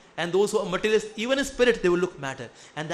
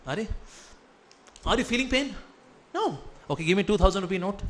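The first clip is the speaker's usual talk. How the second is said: normal talking voice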